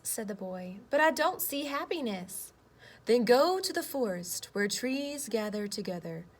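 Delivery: lively picture-book read-aloud with voiced boy and earth; reading to children. Teacher reading a story to her class, lively read-aloud.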